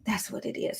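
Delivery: whispering. Real, not acted. Excited breathy self-talk.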